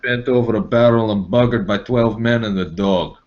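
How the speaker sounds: lightly held mock Russian threat; a hint of a Russian thug; understated Russian accent. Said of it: "Russian accent".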